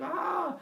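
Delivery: high-pitched mock-alarm "ah"; mock scary "ah". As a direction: cry a high, scared "ah" in jest. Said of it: "Not scary, radioactive".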